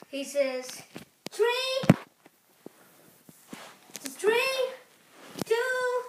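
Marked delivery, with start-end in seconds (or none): 1.3-1.7 higher starting call for a game; countdown call. Pretend. 4.1-6.0 higher-pitched, punchy starting count; countdown. Pretend.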